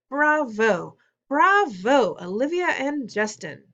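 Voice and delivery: loud, high, drawn-out bravos; congratulatory cheer. A narrator's reading. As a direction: hail the performers grandly. Teacher cheering and clapping.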